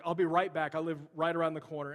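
loud, hurried parting call; lightly acted shout on the way out; calling out in retelling. Woman calls out while leaving.

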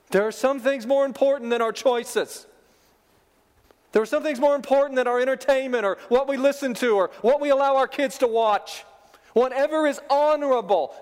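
preaching at full force. Acted. Preacher urging the church to guard their homes, rising intensity. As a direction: drive the point home to the congregation.

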